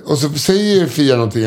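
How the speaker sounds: normal talking voice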